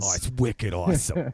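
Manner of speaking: "wicked awesome" in broad Boston; Boston accent. Shown as a demonstration. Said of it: "say "wicked" in our sentences".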